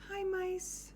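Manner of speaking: high, cheery little hello; Madeline greeting. Acted. Teacher voices Madeline greeting the mice, high. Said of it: "She says".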